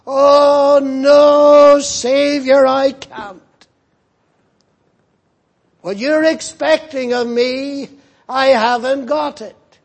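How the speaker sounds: high, loud cry of despair; anguished outcry. Acted. The child's father crying out to the Savior in anguish. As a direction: cry it out in agony, near a shout. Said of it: "and said with tears".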